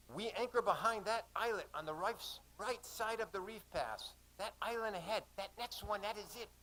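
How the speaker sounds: high-pitched excited pilot's voice; animated directions from a ship's pilot. Acted. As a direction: point it out eagerly, pitched well up. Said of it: "Pilot John became very animated".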